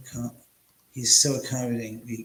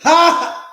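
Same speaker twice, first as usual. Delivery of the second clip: single high, very loud "Ha!"; loud forced laugh; 13 dB louder than usual. Shown as a demonstration. Testing whether a laugh triggers the gadget, loud forced laugh. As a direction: bark one sharp, high laugh. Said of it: "my laugh triggered it".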